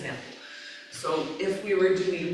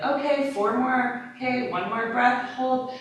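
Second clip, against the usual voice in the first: higher, louder power-yoga count; energetic class cue; 6 semitones higher, 4 dB louder than usual. Shown as a demonstration. Teacher rallying students in a power round, energetic and louder. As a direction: push them through with more energy. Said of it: "I'll have more energy to my voice".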